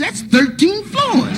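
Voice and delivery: squeaky slow panicked voice; high, slow panic. Retold or imitated. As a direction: stretch it out in high alarm. Salesman's panicked reply.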